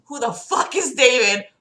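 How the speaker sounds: ordinary delivery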